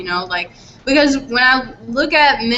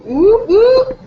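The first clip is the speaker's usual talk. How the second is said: mock cheer; 8 dB louder than usual. Real, not acted. Mock cheer at wage news.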